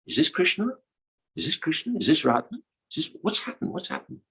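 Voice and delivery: high, hushed puzzled questions; baffled gopis, voiced high and light; confused gopis. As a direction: question it softly in a high girlish voice.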